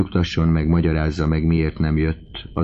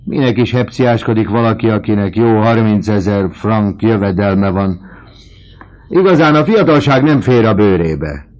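loud, raised-pitch scolding; scoffing outburst; 6 semitones higher, 9 dB louder than usual. A narrator's reading. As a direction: scoff loudly at the folly of youth. Vautrin scoffs at young duellists.

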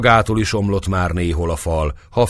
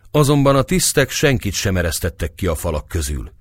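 plain reading voice

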